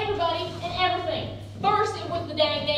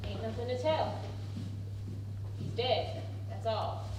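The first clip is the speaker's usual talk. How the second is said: low, soft, heavy with grief; quiet grief; 7 semitones lower, 8 dB quieter than usual. Acted. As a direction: say it flat and low, holding back grief. Breaking news of son's death.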